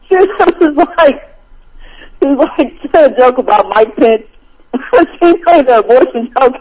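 high, giggly retelling broken by laughter; laughing while retelling. Real, not acted. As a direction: struggle to get the joke out through laughs. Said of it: "That still make me laugh".

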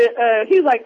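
usual speaking voice

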